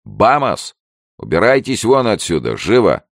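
loud, high-pitched shout; barked order. A narrator's reading. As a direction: bark at an intruder to get out. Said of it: "и гаркнул". (громкий окрик)

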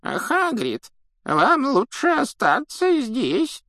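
put-on very high, thin elderly voice; slow squeaky advice from an ancient official; creaky old man. A narrator's reading. Same old official speaks on.

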